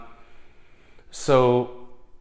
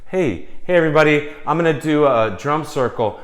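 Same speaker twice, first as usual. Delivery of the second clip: higher and louder hostess call; calling out to party; 4 semitones higher, 5 dB louder than usual. Retold or imitated. Alanis calling her guests to a drum circle, loud call. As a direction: gather the whole room, bright announcement.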